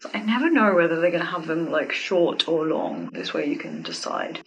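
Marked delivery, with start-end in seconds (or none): none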